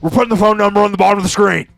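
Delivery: loud, high, hammed-up infomercial shout; Alex Jones impression. Host to viewers pitching a fake deal, loud mock Alex Jones. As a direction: bellow the offer like a conspiracy radio host. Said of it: "an Alex Jones kinda feel there".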